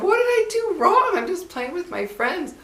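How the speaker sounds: a hint of a startled kid asking what she did wrong; shocked little girl. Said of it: "I was shocked".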